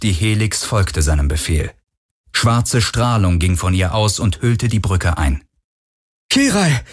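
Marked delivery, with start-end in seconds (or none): 6.3-6.8 breathless. A narrator's reading. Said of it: "rief Garland atemlos".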